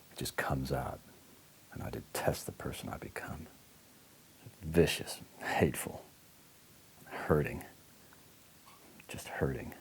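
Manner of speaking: hushed self-loathing; ashamed whisper. Acted. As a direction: let the confession fade to breath. Confessing shame, voice sinks.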